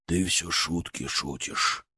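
low, slow, firm rebuke; stern father. Acted. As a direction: scold him, hard and stern. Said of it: "голос отца тоже изменился, приобрёл твёрдость, строгость".

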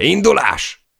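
high, loud command; ordering the crew to go; shouted order. Acted. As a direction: bark the order to set off. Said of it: "kiáltott Chay a legénységre".

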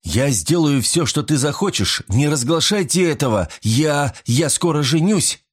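high-pitched, halting plea; moderately acted panic; frightened pleading. A narrator's reading. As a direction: plead desperately, raising the pitch. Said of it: "которого уже била дрожь".

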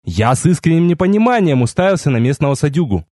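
plain reading voice

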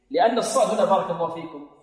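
ordinary delivery

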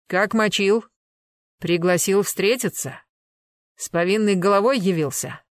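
loud, pressing string of accusing questions; hard interrogation. Acted. Enforcer questioning the nephew about his uncle's killing, hard interrogation. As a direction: grill the suspect forcefully.

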